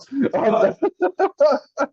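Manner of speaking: a high, loud, real laugh bursting out; high burst of laughter. Real, not acted. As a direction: crack up at the joke, high and loud. Laughs at spirit's joke.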